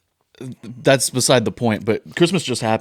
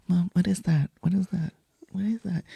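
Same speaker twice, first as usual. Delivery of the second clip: high, soft mock murmur; puzzled murmuring; 7 semitones higher, 9 dB quieter than usual. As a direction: imitate onlookers quietly asking around. Crowd murmurs, puzzled by the gift.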